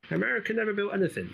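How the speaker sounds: high-pitched, quick mocking quote; hurried, high mimicry of a scoffer; mock naysayer. Voicing dismissive people he argues against.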